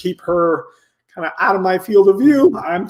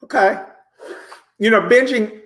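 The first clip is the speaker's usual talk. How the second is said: normal talking voice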